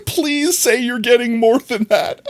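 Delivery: high, drawn-out tearful whine through laughter; hammed-up crying plea; mock desperate pleading. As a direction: exaggerate a squeaky, weepy beg, stretching the words. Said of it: "they didn't actually ask like that".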